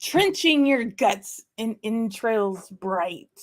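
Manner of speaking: slow, grand reading of the Burns address; theatrical poem recital. Acted. Host reciting the haggis address to viewers, slow theatrical declaiming. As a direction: declaim it slowly with mock gravity.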